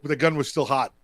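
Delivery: ordinary talk, nothing marked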